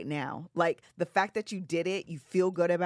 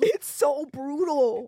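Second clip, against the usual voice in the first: dramatic complaint; 8 semitones higher, 5 dB louder, much slower than usual. Real, not acted. Complaining about massage chairs.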